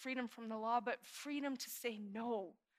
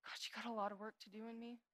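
humble admission; 7 dB quieter than usual. Retold or imitated. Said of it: "I say".

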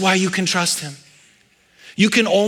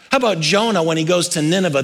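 ordinary delivery

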